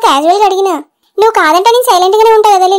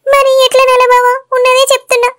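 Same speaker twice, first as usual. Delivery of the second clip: higher, weepy acted voice; tearful protest; 5 semitones higher than usual. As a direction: protest to your husband through tears. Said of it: "నువ్వు ఏడుస్తున్నావు కదా". (ఏడుపు గొంతుతో నిరసన)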